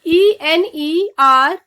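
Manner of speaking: each letter spelled slowly and apart. Shown as a demonstration. Teacher spelling a word slowly for students.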